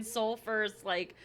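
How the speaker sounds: ordinary delivery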